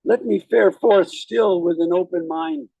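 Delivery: slow, solemn recitation with rising emphasis; poem reading. Acted. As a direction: read the verse like a eulogy. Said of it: "I'll read a little poem".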